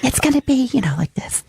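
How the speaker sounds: high whiny voice trailing to a whisper. Shown as a demonstration. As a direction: whine it, then drop to a whisper. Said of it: "in, like, a whiny voice so that you can see the range".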